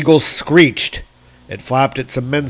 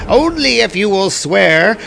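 high, louder eagle voice laying down terms; eagle's condition; 8 semitones higher, 4 dB louder than usual. A narrator's reading. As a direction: hold out the condition like a bird of prey. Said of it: "said the eagle".

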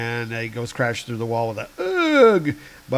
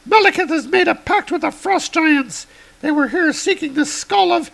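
much higher heroic woman; high woman's voice; 4 dB louder than usual. Acted. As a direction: lift the voice high for Girl Thor. Girl Thor voiced.

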